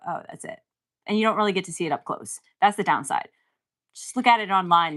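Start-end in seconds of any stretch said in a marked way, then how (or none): 0.1-0.6 whispered letdown; unimpressed whisper. Retold or imitated. Seeing the painting in person, disappointed whisper. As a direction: breathe it out, underwhelmed. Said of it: "you go".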